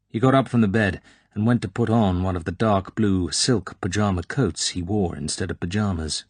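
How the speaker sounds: ordinary delivery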